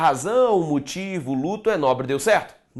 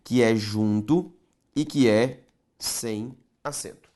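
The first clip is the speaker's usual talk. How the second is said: slow, spelled out; 4 semitones lower, slower than usual. Shown as a demonstration. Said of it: "o emprego adequado seria de um porquê".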